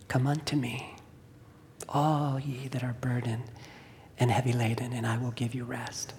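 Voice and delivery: soft whispered words of God; whispered scripture. Retold or imitated. Recalling God's voice speaking Matthew in his head, whispered. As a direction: breathe the verse out gently, hushed. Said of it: "he quoted Matthew eleven twenty-eight to me".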